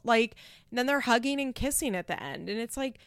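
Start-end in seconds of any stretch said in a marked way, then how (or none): none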